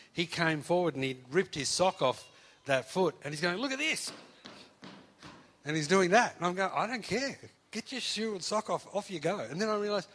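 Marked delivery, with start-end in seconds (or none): 3.6-4.1 high, eager look at this; excited show-off. Retold or imitated. Healed boy shows pastor his foot, excited. 6.7-9.4 higher, breezy dismissive brush-off; mock brush-off. Pastor retells shooing the healed student off, dismissive.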